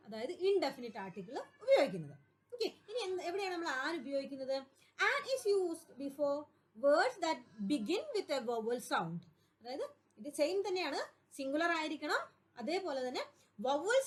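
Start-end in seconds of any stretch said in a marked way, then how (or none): 5.1-9.2 very slow, every word spaced out; slow dictation. Shown as a demonstration. Teacher reads rule for copying.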